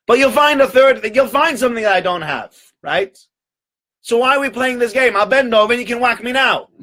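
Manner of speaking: high, insistent argument; exasperated, high-pitched tirade; defiant host. Acted. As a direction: confront the duke head-on, pitch held high. Host defiantly confronts the duke.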